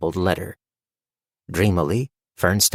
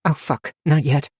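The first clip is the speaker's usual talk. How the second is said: sudden desperate inner cry, pitched up; a panicked thought breaking through; mild desperate gasp of a thought; surprising desperation; 7 semitones higher than usual. A narrator's reading. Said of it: "with surprising desperation".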